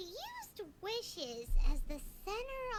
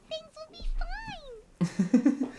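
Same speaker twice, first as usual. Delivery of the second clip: drawn out, much louder and higher cheer; excited; 7 semitones higher, 13 dB louder, much slower than usual. Acted. Paimon cheering to the Traveler about the perfect plan, loud excited drawl. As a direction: cheer it out slowly, thrilled.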